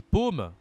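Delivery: paume with a closed, rounded O; circumflex ô. Shown as a demonstration. Teacher names the new word, closed O in paume. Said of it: "accent circonflexe, ça devient".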